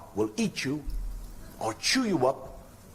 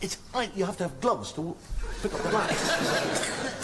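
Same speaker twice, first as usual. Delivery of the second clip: laughing through it; 6 semitones higher, slower than usual. Acted. Stand-up joke about cold beer.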